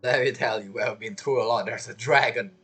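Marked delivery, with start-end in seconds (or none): none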